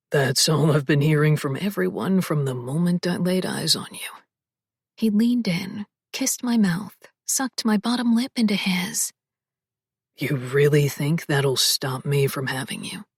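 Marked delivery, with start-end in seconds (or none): 0.1-4.1 low, husky male voice, whispered; lower, intimate man's voice; male love interest voice. Acted. 10.2-13.0 low, husky male voice, whispered; lower, intimate man's voice; male love interest voice. Acted. Male lead teasing.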